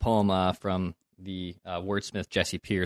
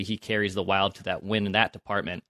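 ordinary talk, nothing marked

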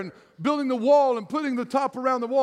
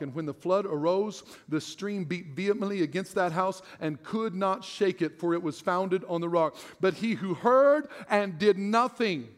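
scripture reading; 4 semitones lower than usual. Acted. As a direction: read the passage low and measured like from the pulpit. Reads scripture before sermon.

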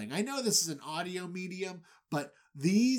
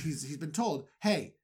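ordinary talk, nothing marked

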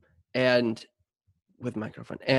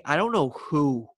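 usual speaking voice

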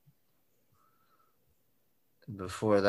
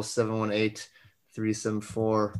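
ordinary delivery

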